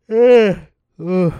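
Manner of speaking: loud eh then ugh; disgusted groan. Real, not acted.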